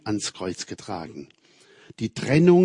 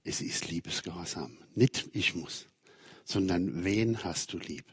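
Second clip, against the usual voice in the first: much softer, lower, a hint of an old lady; gentle retold advice; 4 semitones lower, 8 dB quieter than usual. Preacher retelling an old lady's gentle counsel, hushed. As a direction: hand on the words softly, like her. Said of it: "eine alte Dame in Thüringen, gesagt".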